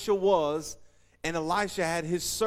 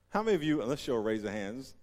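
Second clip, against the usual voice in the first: normal talking voice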